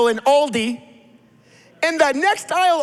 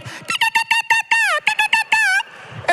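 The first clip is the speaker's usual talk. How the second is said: put-on very high, piping kid's bird chirp; excited bird calls. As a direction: chirp like a thrilled toddler bird. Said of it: "he gets crazy excited".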